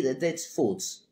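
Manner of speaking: slow, put-on little cat voice; playful pet voice pleased with feet; cat's voice. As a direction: savour it as the cat would. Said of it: "And he goes".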